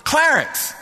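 Clarence yelled out high and loud, mom calling from the door; yelled name. Retold or imitated. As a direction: call "Clarence" sharply across the yard. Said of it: "she yelled".

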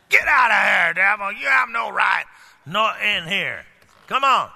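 loud, high commanding shout; authority in voice. Shown as a demonstration. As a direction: throw the devil out with full authority. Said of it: "you got to have some authority in your voice".

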